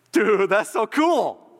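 acted slow, high-pitched enthusiasm; thrilled. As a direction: stretch it out in dude-like awe. [name] acted, delighted by plan.

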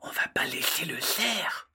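hushed protest; whisper. Acted. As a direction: whisper firmly. Hiding from hunters.

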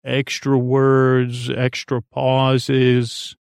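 drawn out very slowly, words spaced wide; extra pauses. Shown as a demonstration. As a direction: stretch each word and leave long gaps. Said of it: "lots of pointless meanders".

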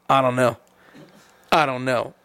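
low, deflated imitation of stumped mechanics; mock helpless, pitched well down; clueless mechanic. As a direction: drop low for a hint of a useless mechanic. Baffled mechanics' answer acted.

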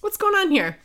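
high, curious musing; retold suspicious wondering. Woman who understands the French, starting to get suspicious. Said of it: "this is where she starts going".

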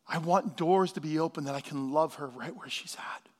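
very soft, low, reverent wonder; hushed, as if holding a newborn grandchild; tender hush. Retold or imitated. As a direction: let it sink to a low, moved near-whisper. Retells tearful moment holding first grandchild.

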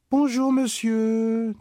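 put-on slow, high, polite child; polite greeting. Agathe politely greeting the horse owner, slow and high. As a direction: greet the stranger sweetly and slowly. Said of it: "dit poliment Agathe".

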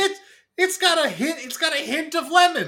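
very high, loud, laughing; laughing squeal. Retold or imitated. Laughing mock quote.

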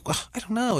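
high-pitched imitation of a fussy actress; exaggerated, put-on helplessness; mock dithering. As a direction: mimic her fretting, pitch way up. Mimics actress miming while typing.

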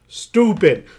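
loud, high outburst of disgust; loud scorn. Real, not acted. Repeats the word as exclamation.

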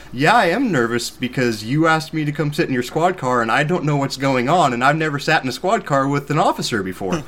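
emphatic retold self-defence; retold exasperated reply. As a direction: spell out the obvious with emphatic exasperation.

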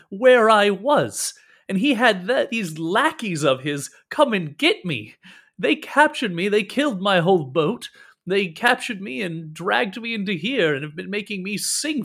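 high, aggrieved rant with heavy emphasis; indignant. Acted. Captive recounts being kidnapped to rescuers, indignant. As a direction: pour out the injustice. Captive rails at his capture.

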